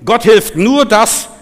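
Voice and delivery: forceful preaching. Real, not acted. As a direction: proclaim it to the congregation. (mit Nachdruck gepredigt)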